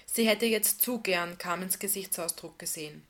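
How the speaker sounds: usual speaking voice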